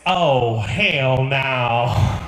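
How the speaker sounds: deep, loud, drawn-out objection; guy in the back. Acted. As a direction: boom it out low in disbelief. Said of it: "one guy in the back goes".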